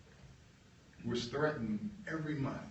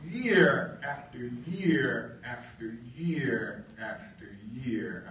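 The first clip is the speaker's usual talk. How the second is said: slow repetition; much slower than usual. Real, not acted. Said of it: "emphatic repetition".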